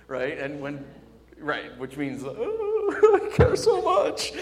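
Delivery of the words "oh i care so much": high, loud whiny cry, breaking into a laugh; mock wailing. Preacher mimicking a secretly hurting teen, mock wailing. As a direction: wail it in an exaggerated high voice, laughing. Mocks rebels' hidden feelings.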